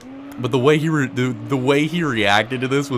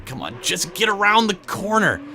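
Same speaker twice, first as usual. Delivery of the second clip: normal talking voice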